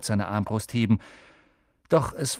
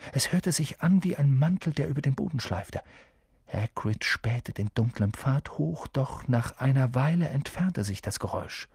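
usual speaking voice

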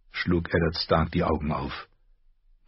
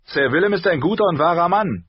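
higher, louder knight; knight's voice; 12 semitones higher, 6 dB louder, faster than usual. A narrator's reading. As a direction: defend Willem firmly. (Ritterstimme, fest und hoch)

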